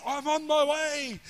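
high, drawn-out retold call; acting out an elated, urgent answer; excited reply. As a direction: call it out eagerly down the phone.

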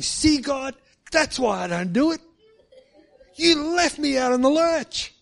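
high, loud, near-crying complaint at God; livid protest to God. Retold or imitated. Preacher retelling his furious complaint to God, high and loud. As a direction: let the frustration break out high and loud. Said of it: "I was livid".

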